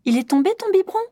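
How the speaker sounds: put-on high lifted question to a toddler; rising question. Shown as a demonstration. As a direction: ask it up high and bright. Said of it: "Un, reformulation".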